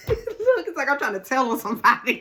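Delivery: loud, high laughing speech; cracking up at yourself, words tumbling out. Real, not acted. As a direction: talk through a real giggle. Cracks up at own excitement.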